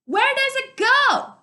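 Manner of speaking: very high, loud, slow question; shouting over the river. Acted. Dune shouting his question to Arlen by the river. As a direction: yell the question over the roar. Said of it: "Dune asked".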